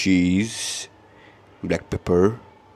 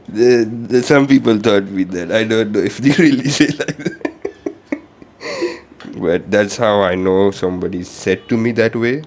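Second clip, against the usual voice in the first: excited; 3 semitones higher, 8 dB louder than usual. Real, not acted. Excited laughing aside to viewers.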